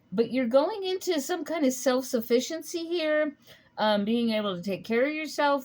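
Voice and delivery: normal talking voice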